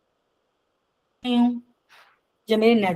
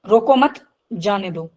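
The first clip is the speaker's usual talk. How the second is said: "रोको मत" grouped together, pause, then "जाने दो" falling lower; pause after मत; 3 semitones lower than usual. Shown as a demonstration. Teacher repeating the example for students, pause after मत. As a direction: keep "रोको मत" as one unit, then a separate "जाने दो". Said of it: "message words तो same है".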